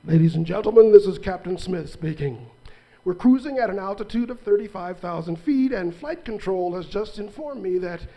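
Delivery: pilot over the intercom; loud, flat PA announcement voice; flat echoey pa page. Acted.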